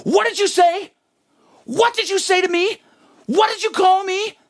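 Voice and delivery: the teacher whipping round, indignant; outraged teacher. Retold or imitated. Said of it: "she whips around and goes".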